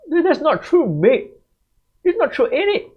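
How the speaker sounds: mock British accent. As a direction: imitate a student faking a British accent. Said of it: "they try to mimic some kind of accent".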